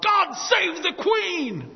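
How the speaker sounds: imitating a man's loyal shout from the crowd; shouted cheer. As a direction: bellow it at the passing Queen. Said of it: "some man right behind me yells out".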